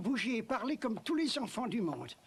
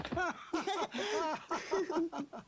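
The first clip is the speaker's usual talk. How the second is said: happy laugh; 7 semitones higher than usual. Acted.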